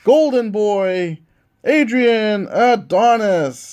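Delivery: put-on Golden Boy, Adrian Adonis drawn out slowly; ring announcer. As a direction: stretch the winner's name like a ring announcer. Announces the winner.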